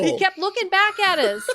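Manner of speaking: laughing at the memory. Real, not acted. Laughing retelling.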